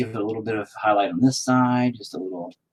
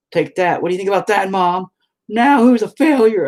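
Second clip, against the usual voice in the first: high, loud gloating at mom; hammed-up taunting shout; mock triumph; 11 semitones higher, 9 dB louder than usual. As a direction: crow it up high, playfully defiant.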